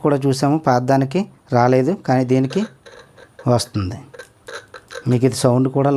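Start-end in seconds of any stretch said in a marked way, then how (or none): none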